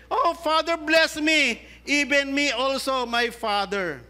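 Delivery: high, desperate plea to a father; pleading Esau. Acted. Esau begging his father for a blessing, pitched high. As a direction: beg him for any blessing left.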